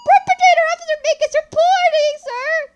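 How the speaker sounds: very high shouted report; Officer Minkins. Acted. Officer reporting for duty to a superior, high full shout. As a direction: bellow it like an over-eager cadet.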